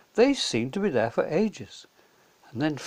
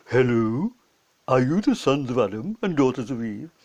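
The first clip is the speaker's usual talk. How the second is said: lower, louder beaver voice; friendly low animal voice; 3 semitones lower, 3 dB louder than usual. A narrator's reading. Mr Beaver greeting the children, low and friendly.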